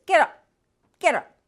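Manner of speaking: loud, harsh "get up" barked at the horse; harsh yell. Acted. Mister Garcia yelling at the fallen horse. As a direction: yell at him to get on his feet.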